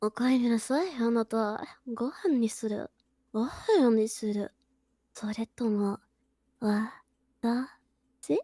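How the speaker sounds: sultry voice. Acted. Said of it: "say it in, like, a sultry voice".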